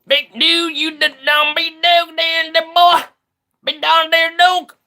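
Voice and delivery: mock the yokels in a high twang. Mocking Trump supporters' voice.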